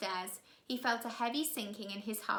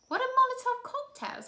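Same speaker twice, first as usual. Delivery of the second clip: put-on much higher, louder boy; a puzzled young boy; curious boy; 5 dB louder than usual. Klaus asking his sister about bombs, high and curious.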